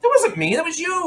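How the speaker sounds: high-pitched, exaggerated self-defence; squeaky mock protest, tossing the blame back; acted protest. Imitating MacGyver defending himself to the girl, high mock protest.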